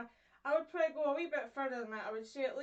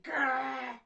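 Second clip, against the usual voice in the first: loud rolled growl; harsh growl; 6 dB louder than usual. Shown as a demonstration. Teacher showing a harsh G, rough growl. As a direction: growl it out hard. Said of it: "So G is quite a harsh sound".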